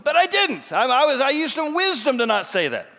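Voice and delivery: loud, high-pitched outburst; hammed-up yelled disclaimer; emphatic denial. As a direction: shout it in comic self-defence. Mock-triumphant denial after acted argument.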